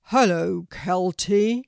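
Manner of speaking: big booming teacher-tree greeting, loud; deep, loud voice. A narrator's reading. Oak tree greets Kelty in a loud, booming voice. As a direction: boom it out like a talking oak. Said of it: "said a deep, loud voice".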